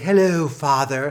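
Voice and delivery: mock British butler-ish child voice; British accent. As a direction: greet your father like a proper English child. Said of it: "having adopted some sort of a British accent".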